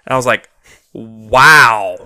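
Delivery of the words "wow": amazed wow. Retold or imitated. Retold own amazed reaction.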